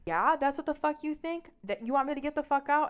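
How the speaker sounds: high, loud mocking challenge; angry comeback. Boyfriend challenging girlfriend's order to get out, angry and high.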